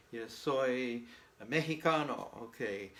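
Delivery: ordinary talk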